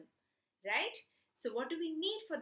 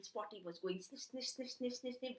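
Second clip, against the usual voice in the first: sniffing; 7 dB quieter than usual. Acted. Puppy sniffing in story.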